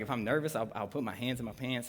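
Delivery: ordinary talk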